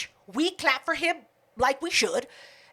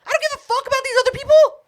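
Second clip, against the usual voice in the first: loud high heckle, fast at the end; mock drunken heckle; 10 semitones higher, 5 dB louder than usual. Friend retelling her heckle at the host, half-shouted. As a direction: yell it at the host like a rowdy fan.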